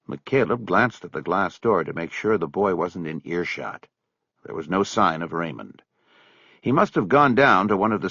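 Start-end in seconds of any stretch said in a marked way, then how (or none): none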